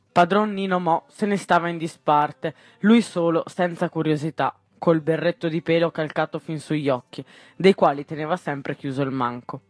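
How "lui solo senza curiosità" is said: usual speaking voice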